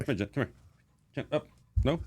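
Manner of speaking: soft, high coaxing with a chuckle; coaxing dog. Real, not acted. Veteran softly coaxing his dog up for the camera. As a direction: gently urge the dog up.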